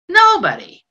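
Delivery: loud, high burst; emphatic. Acted. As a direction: blurt the word Nobody out loud. Emphatic repetition.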